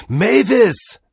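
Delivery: loud, high shout of her name; calling after her. Acted. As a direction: yell to someone walking away. Cordell calls after her as she leaves. (laut hinterherrufen)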